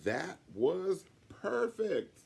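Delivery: teacher thrilled with the poem; delighted praise. Acted. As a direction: savour the word perfect. Miss Hoot delighted.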